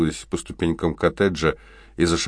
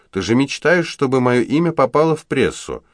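exasperated outburst; 9 semitones higher, 5 dB louder than usual. A narrator's reading. Angel snapping at her mother.

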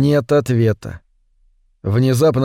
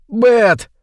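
high-pitched cry of alarm; frightened shout; 9 semitones higher, 3 dB louder than usual. A narrator's reading. Man pinned by creature shouting to Бет, frightened shout. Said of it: "выкрикнул он".

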